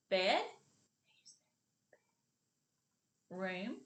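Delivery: "Bed" and "Room" as separate syllables with a long pause; syllables apart. Shown as a demonstration. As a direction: leave a gap for the child to repeat each part. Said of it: "as we say each part of the word".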